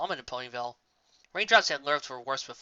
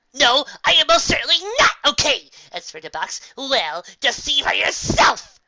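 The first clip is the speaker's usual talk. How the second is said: outraged; 10 semitones higher, 11 dB louder than usual. Acted. Trixie outraged about her hat.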